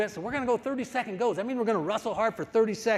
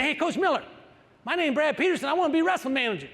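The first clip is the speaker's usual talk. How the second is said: higher-pitched, eager youngster introducing himself; eager kid wrestler; 6 semitones higher, faster than usual. Retold or imitated. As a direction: imitate a keen kid, pitched up. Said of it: "And he said".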